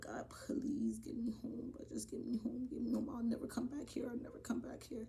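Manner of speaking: whispered desperate prayer to God; pleading prayer. Retold or imitated. Storyteller re-enacting praying to get home safe, whispered plea. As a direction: beg under your breath, barely voiced. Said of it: "praying to God".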